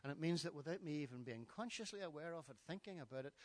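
ordinary talk, nothing marked

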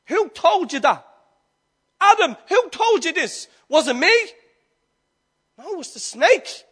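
loud, rising preacher's questions; fiery sermon cadence; preaching at full force. Acted. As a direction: hammer each who told you home.

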